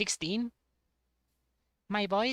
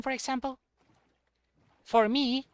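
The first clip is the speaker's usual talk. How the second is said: ordinary talk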